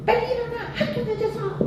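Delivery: very high, held-back imitation of shouting; shrieky pitch at soft volume; mock nagging yell. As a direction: mimic a parent yelling kids out of bed. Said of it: "이렇게 소리 지른 적 없어요".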